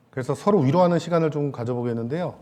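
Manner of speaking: ordinary talk, nothing marked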